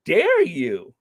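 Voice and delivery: slow, drawn-out how dare you; hammed-up indignation at the invite; mock outrage. Mock outraged at a seniors' invite. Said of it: "I'm like".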